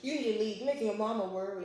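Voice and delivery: loud mock scolding; retold telling-off. Retelling someone ordering a person out, loud mock telling-off.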